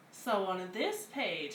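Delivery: ordinary delivery, nothing marked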